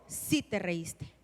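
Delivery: réplica grave, lenta y más suave; el Señor. Acted. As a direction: contradice despacio, con autoridad tranquila. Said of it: "el Señor contestó". (voz del Señor, grave y pausada)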